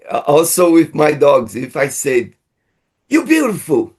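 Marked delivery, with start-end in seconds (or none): 3.1-3.9 mock angry tone. Shown as a demonstration. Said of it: "dengan nada yang seperti marah".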